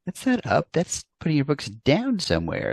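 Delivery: mock objection. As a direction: argue it lightly, like a confused literal-minded kid. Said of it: "I was like".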